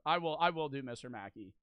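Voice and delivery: ordinary talk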